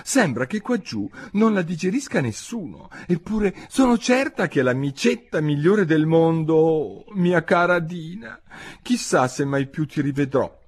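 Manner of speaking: high, plaintive lament; wistful, high little-girl sorrow; melancholy. A narrator's reading. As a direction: pine aloud for a missing pet. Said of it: "disse fra sé in tono malinconico".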